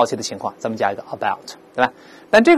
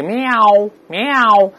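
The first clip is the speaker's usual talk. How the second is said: high, drawn-out imitated "miaow"; cat meow; 6 semitones higher than usual. Shown as a demonstration. Teacher likening the sound to a cat's cry, high meow. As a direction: mimic a cat to show the vowel. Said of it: "有点像猫叫那个喵".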